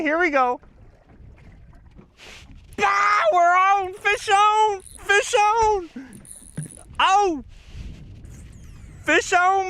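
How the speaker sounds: high, loud, thrilled yelling; real thrill of a hooked fish; excited shout. Real, not acted. Hooks a fish live.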